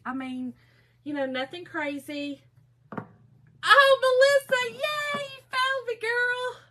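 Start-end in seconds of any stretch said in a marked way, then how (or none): 3.6-6.6 very high-pitched happy squeal; delighted greeting. Real, not acted. Crafter greeting a viewer who found her, high excited squeal. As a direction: squeal a welcome to a friend.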